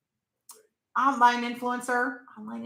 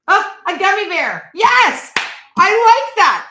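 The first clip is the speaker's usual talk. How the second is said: much higher, loud thrilled cheer; delighted; 11 semitones higher, 11 dB louder, slower than usual. Real, not acted. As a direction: burst out happy at the punchline. Delighted by viewer's joke.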